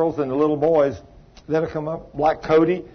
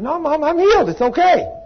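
put-on high, louder child voice; little boy insisting; 12 semitones higher, 4 dB louder than usual. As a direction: play the small boy standing firm with his mom. Cody insisting to his mother.

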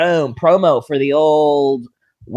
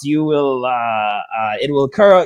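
ordinary talk, nothing marked

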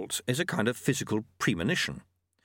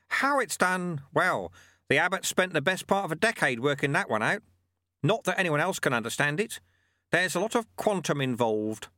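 put-on higher, patient old mentor voice; wise old monk; 6 semitones higher than usual. A narrator's reading. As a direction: teach the lesson like a wry old sweeper. Lutze explains in character.

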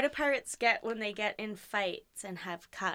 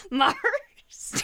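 laughing; 5 dB louder than usual. Real, not acted. Delivering own pirate punchline.

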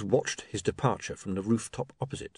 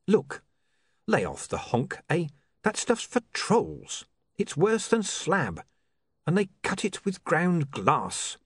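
higher, slow, insistent crook; exasperated smooth schemer; 7 semitones higher, slower than usual. Acted. Mister Pin warns his partner off bad drugs, slow, higher-pitched, insistent. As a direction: warn a dim partner slowly and firmly.

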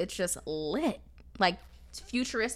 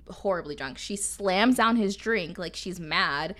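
ordinary talk

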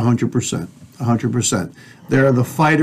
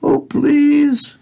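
high, whimpering mock plea; mock terrified begging; much slower than usual. Host mocking people hiding in the basement, mock terrified begging. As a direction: beg in a put-on, high-pitched helpless wail. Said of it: "be terrified and say".

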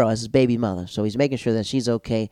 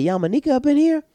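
excited question; 4 dB louder than usual. Retold or imitated. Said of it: "I was like".

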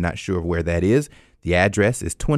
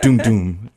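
imitated dramatic two-note sting, louder; mock TV sting; 5 dB louder than usual. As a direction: hum the crime-show sting. Imitating the SVU sound sting.

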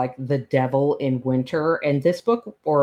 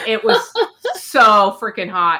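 excited; 6 semitones higher, 5 dB louder than usual. Real, not acted. Gushing about the steamy book.

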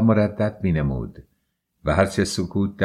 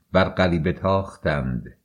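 ordinary delivery

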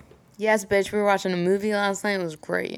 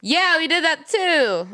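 casual; 6 semitones higher, much slower than usual. Retold or imitated. Brushing off mom's questions.

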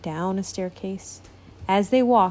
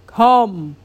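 a big loud booming summons; booming call; 8 dB louder than usual. A narrator's reading. King calling the boy in, booming loud. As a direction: boom it across the room. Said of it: "a loud voice suddenly boomed".

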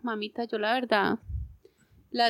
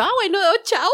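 high, louder, quick sign-off; breezy retold goodbye; 10 semitones higher, 4 dB louder than usual. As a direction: toss off a singsong goodbye. Retells breezy goodbye to doctor. (despedida aguda y despreocupada)

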